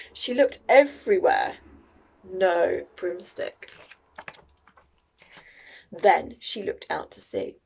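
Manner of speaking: usual speaking voice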